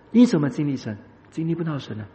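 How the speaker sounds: normal talking voice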